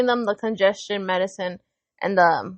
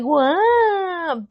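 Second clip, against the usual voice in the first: high, drawn-out what; mock a kid's annoyed answer; stretched, pitched-up snotty response; imitated rude reply; 6 semitones higher than usual. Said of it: "and you're like".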